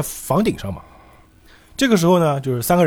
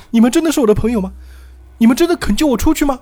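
high, loud pleading damsel voice; hopeful woman; 10 semitones higher, 4 dB louder than usual. Acted. Woman asks strangers to rescue her, hopeful. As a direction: beg for reassurance in a high female voice. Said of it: "哎，这女人说".

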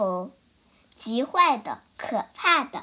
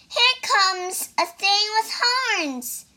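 shouting rabbit; 8 semitones higher than usual. Acted. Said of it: "shouted a rabbit".

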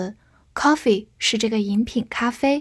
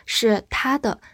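ordinary talk

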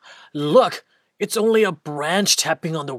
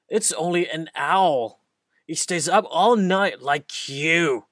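normal talking voice

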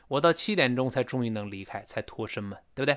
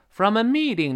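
"meeting" with ME pitched high, then falling; 6 semitones higher, 3 dB louder than usual. Shown as a demonstration. Teacher modelling From a meeting, high ME. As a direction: lift the first syllable of "meeting" well up. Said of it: "那个me读高一些".

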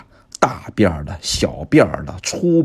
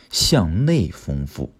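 slow, emphatic key term; much slower than usual. Real, not acted. Repeating key teaching term.

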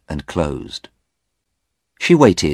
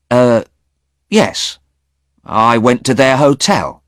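high, loud startled question; startled young man; surprised young man; 8 semitones higher, 7 dB louder than usual. Acted. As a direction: woken too early and baffled. Stephen voiced.

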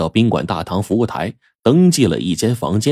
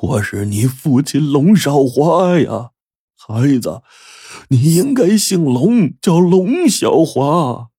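put-on slow, high, trembling old father; overwhelmed father's confession; quivering, emotional; 7 semitones higher, slower than usual. A narrator's reading. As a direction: reveal yourself with quivering lips, drawing the words out. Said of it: "黄德贵就哆嗦着嘴唇".

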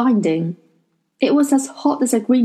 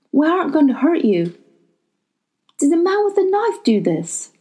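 put-on quiet boy voice; 8 semitones higher than usual. A narrator's reading. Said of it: "said Will quietly".

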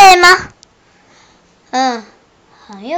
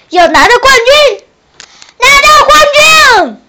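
rally the team, shout it out; cheering shout; 10 semitones higher, 6 dB louder than usual. Pretend. Kids pretending to be athletes, shouting to win the championship.

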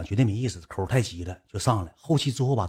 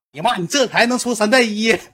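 mock scolding; 10 semitones higher, 4 dB louder than usual. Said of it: "说了一句话：".

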